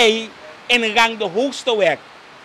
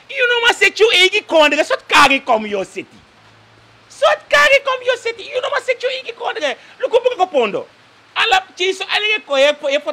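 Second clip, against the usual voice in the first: much higher, louder mock imitation of the president; 10 semitones higher, 5 dB louder than usual. Caller mocks the president's promises, high hammed-up voice. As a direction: ham up the president's boasting, high and loud.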